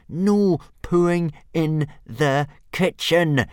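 slow, high, loud weary telling-off; boy scolding his pet dragon, exasperated. A narrator's reading. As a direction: spell it out for the hundredth time. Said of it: "for the hundredth time".

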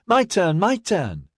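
put-on high-pitched victorious child's call; child's shout. A narrator's reading. Little girl holding the toy aloft, shouting.

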